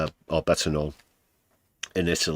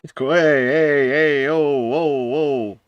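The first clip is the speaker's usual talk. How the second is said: mock calming shout; 8 semitones higher, 5 dB louder than usual. Streamer mimics calming a brawl.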